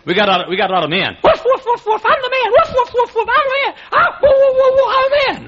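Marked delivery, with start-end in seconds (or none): 1.2-5.5 very high falsetto barking boasts; squeaky, frantic mock-macho bark; yapping chihuahua man. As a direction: yap it like a tiny dog acting tough. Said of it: "chihuahua that does all the barking".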